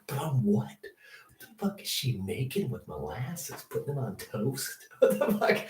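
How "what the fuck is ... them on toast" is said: softer puzzled whisper; hushed baffled question. Real, not acted. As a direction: whisper it like she might hear. Still whispering aside.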